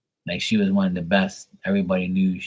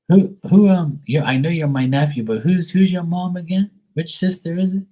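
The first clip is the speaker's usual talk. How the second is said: put-on high, louder confused old auntie; 9 semitones higher, 5 dB louder than usual. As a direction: fumble for it like a forgetful aunt. Aunt voiced, confused.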